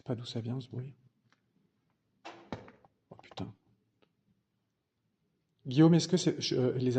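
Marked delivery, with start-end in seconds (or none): 3.1-3.5 scared near-whisper; hushed, spooked by the noise; murmure tremblant. Real, not acted. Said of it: "Je tremble". (chuchotement effrayé)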